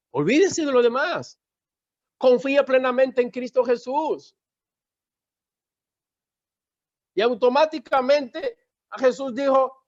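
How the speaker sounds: high-pitched, insistent preacher; fervent preaching. Real, not acted. Preacher urging the church that Satan has no power, fervent.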